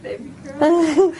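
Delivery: choked up with happy tears; tearful. Real, not acted. Hearing aid switched on, emotional.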